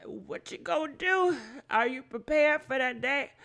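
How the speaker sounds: ordinary delivery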